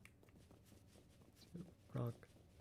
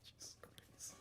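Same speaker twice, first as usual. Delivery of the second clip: breathless relieved whisper; 25 dB quieter, slower than usual. Real, not acted. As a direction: sigh it out, barely voiced. Relief after escape.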